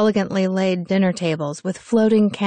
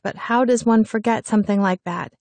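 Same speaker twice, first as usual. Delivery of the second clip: ordinary delivery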